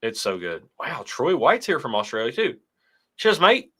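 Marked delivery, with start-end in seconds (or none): 3.2-3.7 a hammed-up Australian "Cheers, mate", pitched higher and louder; mock Australian accent. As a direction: greet the Australian viewer in a jokey Aussie accent. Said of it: "more British or was that Australian".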